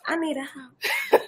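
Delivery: high, slow, breathy plea; half-joking sing-song wish; playful. Real, not acted. Joking repeat, high and soft.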